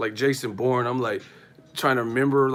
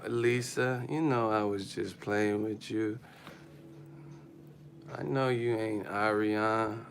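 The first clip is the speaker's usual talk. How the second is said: softer, slow placating tone; soothing boyfriend; 3 semitones lower, 8 dB quieter, slower than usual. Retold or imitated. Boyfriend calming his angry girlfriend, soft slow placating. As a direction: talk her down gently, pleading.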